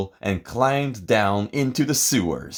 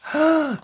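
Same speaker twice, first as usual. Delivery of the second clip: gasp. A narrator's reading. Finds the skunk.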